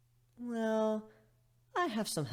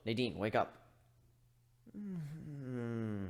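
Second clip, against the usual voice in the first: usual speaking voice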